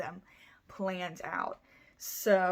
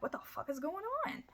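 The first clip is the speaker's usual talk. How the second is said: startled dad; 10 semitones higher, 8 dB quieter than usual. Retold or imitated. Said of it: "the dad's like".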